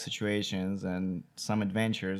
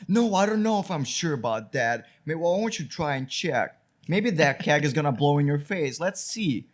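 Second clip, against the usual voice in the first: higher and louder sarcastic taunt; sarcastic Steve; 4 semitones higher, 5 dB louder than usual. Retold or imitated. Steve taunting the new guy about the keg, high sarcastic dare. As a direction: play the snide coworker daring him, voice up high. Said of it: "He's like".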